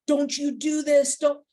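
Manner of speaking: slow, pointed finger-wag of a warning; mock scolding. Speaker telling the room this is no reprimand, mock scolding. Mimics a scolding, not meant.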